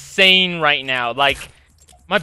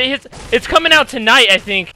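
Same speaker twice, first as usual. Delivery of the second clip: ordinary delivery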